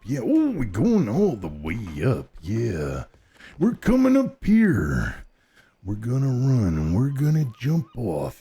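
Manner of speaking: wrestler promo voice with big pitch swings; Macho Man. Acted. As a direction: growl it like a showboating pro wrestler. Keeps up the wrestler voice while playing.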